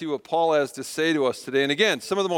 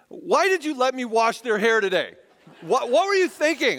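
retold exasperation, pitched up; mock accusing demand, rising higher; frustrated blaming; 5 semitones higher than usual. Husband blaming wife for his mistake, acted frustration. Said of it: "I'm getting frustrated and nervous".

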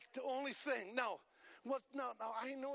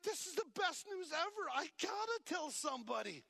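excited; 6 semitones higher than usual. Retold or imitated. Preacher voices excited believer.

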